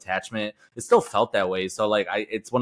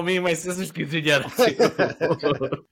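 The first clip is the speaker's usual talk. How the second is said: laughing through the words, pitched up; 6 semitones higher, slower than usual. Real, not acted. Friend laughing to cohosts about his sister's quinceañera, laughing. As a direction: giggle it out while agreeing. Laughing along at shared story.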